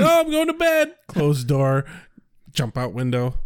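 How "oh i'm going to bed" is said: high-pitched, put-on innocent call; mock bedtime announcement. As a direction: announce it high, as an imitated teen to mom. Voices Buffy fibbing to her mom.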